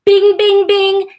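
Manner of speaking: loud, high imitated bell; excited right-answer chime; bing-bing-bing. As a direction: ring out three high bings.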